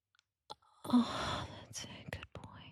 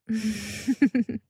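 teasing chuckle; 6 dB louder than usual. Pretend. Performer teasing a partner, short chuckle.

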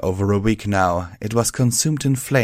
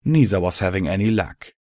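ordinary delivery

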